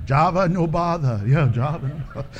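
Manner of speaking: low, gruff mock alien phrase; Star Wars alien voice. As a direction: toss it off like a Star Wars creature. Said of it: "I watch way too much Star Wars".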